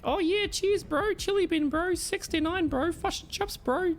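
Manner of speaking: high mock Kiwi voice; exaggerated New Zealand bloke; New Zealand accent. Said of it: "I don't want New Zealand voice acting it".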